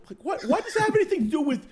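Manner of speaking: high, rising, fast outrage; exasperated disbelief. Real, not acted. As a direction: stammer in mock outrage.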